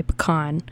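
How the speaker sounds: "pecan" as puh-KAHN, the Southern way; Southern pecan pronunciation. Shown as a demonstration. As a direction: stress the second syllable of "pecan": puh-KAHN. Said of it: "I'm from the South".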